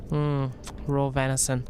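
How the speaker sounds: usual speaking voice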